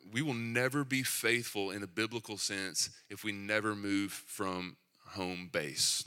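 usual speaking voice

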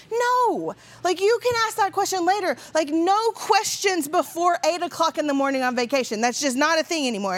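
panicked mom cutting her son off; flustered mom. Retold or imitated. Mom stops son's question.

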